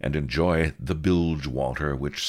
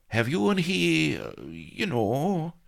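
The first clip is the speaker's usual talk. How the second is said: high, coy hesitant voice; awkward question; 13 semitones higher than usual. A narrator's reading. Blood awkwardly asking Vanity about Pugh, coy and high. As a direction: fish for the delicate answer.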